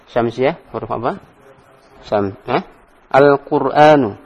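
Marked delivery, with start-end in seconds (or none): 3.1-4.2 Al-Qur'anu drawn out slowly and louder, syllable by syllable; teacher's slow clear model of Al-Qur'anu; slow model reading. Shown as a demonstration. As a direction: spell out Al-Qur'anu slowly for the class. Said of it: "teacher models the word".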